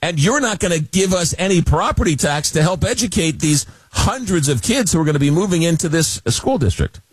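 high-pitched outrage, without real shouting; mock screaming protest. Schools objecting to tax breaks, mock screaming protest. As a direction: imitate indignant schools, pitched well up. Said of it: "screaming at the top of their lungs".

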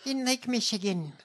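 high, whispery put-on voice; mocking politician impression. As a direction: parody her folksy anecdote in a high voice. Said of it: "You sound insane".

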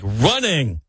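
"running" with the final G dropped. Shown as a demonstration. Met fan showing his hometown speech, dropped G on "running". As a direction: say "running" as runnin', ending in a plain N. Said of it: "dropped the G's".

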